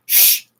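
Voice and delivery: sharp "shh" at a dog; shushing hiss. Shown as a demonstration. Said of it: "like that".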